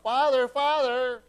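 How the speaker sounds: loud, high, slow calling of Father; mock cry for help, the way he wouldn't call; wrong formal yell. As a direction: bellow a stiff formal Father, Father. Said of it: "I wouldn't yell out".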